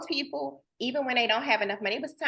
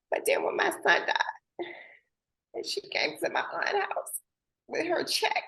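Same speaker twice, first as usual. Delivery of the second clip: barely voiced whisper, higher; 8 semitones higher than usual. Real, not acted. Telling the room about her son's death, whispering. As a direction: let it drop to a grieving whisper.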